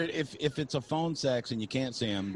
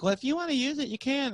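put-on girlfriend voice; 12 semitones higher than usual. Girlfriend quoted in higher voice.

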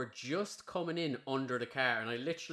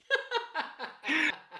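squealing laugh, much higher; high burst of laughter; 3 dB louder than usual. Real, not acted. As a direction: let the laugh break out high. Cracking up at the memory.

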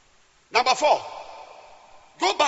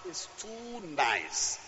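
tender; 4 semitones lower, 11 dB quieter, much slower than usual. Real, not acted. Savouring the scripture softly.

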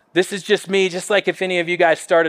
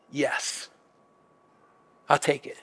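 quiet confession; 4 semitones lower, 8 dB quieter than usual. Retold or imitated. Confessing what he wrote.

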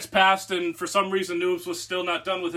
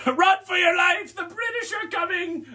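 mock shout; 11 semitones higher than usual. Prankster frolics downhill past the angry man, laughing mock shout. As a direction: bellow it in fake panic, laughing.

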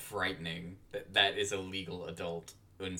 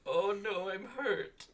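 mock hurt; 11 semitones higher, slower than usual. Mock hurt, high pitched.

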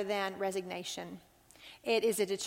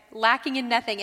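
Lacking in nothing repeated louder, LACKING punched; stress on lacking; 6 dB louder than usual. Real, not acted. Repeating the promise to the women, stressing LACKING. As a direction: lean hard into LACKING and let it fall.

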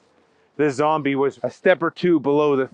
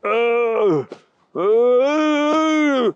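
high, crying moans; zombie groans; 8 semitones higher, 4 dB louder, much slower than usual. Acted. The thing outside the door groaning. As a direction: groan like something undead.

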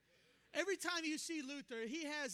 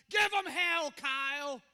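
rousing shouted charge; 12 dB louder than usual. Retold or imitated. Old mentor charging his young preacher, shouting. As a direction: bellow a send-off, acting the fired-up mentor.

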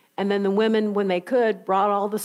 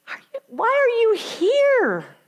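high, slow, astonished angel; incredulous angel; 12 semitones higher, slower than usual. Acted. Angel asks the women why they came, high and baffled.

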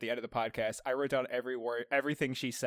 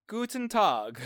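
German greeting; 8 semitones higher, 4 dB louder, slower than usual. Pretend. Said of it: "Oh yeah".

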